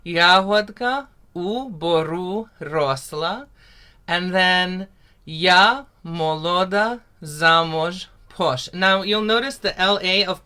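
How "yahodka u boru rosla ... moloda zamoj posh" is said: slow, carefully spoken "Yahodka u boru rosla" with rolled r; teacher modelling a Ukrainian line; slow Ukrainian demo. Shown as a demonstration. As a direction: spell out "Yahodka u boru rosla" slowly for learners. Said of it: "Say that with me".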